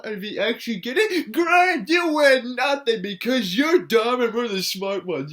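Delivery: shouted, high, gloating jeer; mock taunt. Vlogger mimicking a gloating host with a shout. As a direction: yell it like a smug villain gloating.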